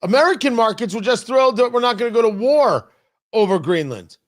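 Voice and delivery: normal talking voice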